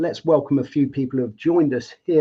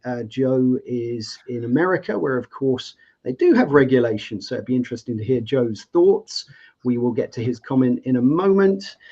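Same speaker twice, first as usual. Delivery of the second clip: ordinary talk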